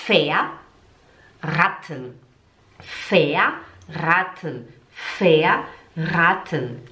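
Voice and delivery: Verraten very slow, split in two parts; slow repeat-after-me. Shown as a demonstration. Teacher asks learners to speak along, slow and stretched. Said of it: "Fala junto comigo".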